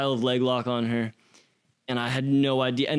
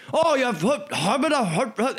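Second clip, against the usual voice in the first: high, garbled angry Irish yelling; hammed-up incoherent shouting; mock yelling; 10 semitones higher than usual. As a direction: imitate a man yelling gibberish at you. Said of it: "he's just yelling at me".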